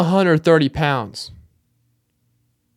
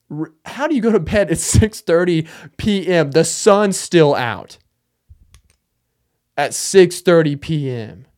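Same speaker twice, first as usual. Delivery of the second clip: drawn-out, louder, comic disbelief; incredulous outrage; 3 semitones higher, 4 dB louder than usual. Acted. Comic ranting at people who go to bed early, incredulous. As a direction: stretch out the words in mock outrage.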